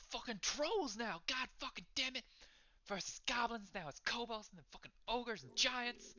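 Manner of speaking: high-pitched, irate patron; high, fed-up character voice; exasperated rant. Acted. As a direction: pile up complaints in mounting outrage. Irate patron rants about monsters.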